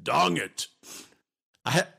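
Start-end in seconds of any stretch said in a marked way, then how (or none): 0.0-0.7 loud, higher mock Russian accent. Podcaster showing listeners his silly accent, mock Russian accent. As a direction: punch it out in a joke Russian accent. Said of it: "my Russian accent".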